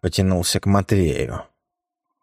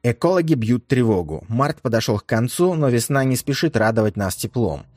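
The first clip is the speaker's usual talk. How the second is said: higher, brisk newsreader delivery; news report; 4 semitones higher, faster than usual. Acted. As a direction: read it like a radio news bulletin. News read within story.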